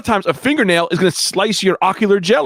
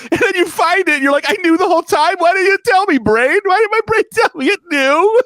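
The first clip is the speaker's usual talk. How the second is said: high, strained fake-tearful stand-up bit; trying not to cry; 11 semitones higher than usual. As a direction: tell the joke while choking back mock sobs. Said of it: "keep trying not to cry throughout my set".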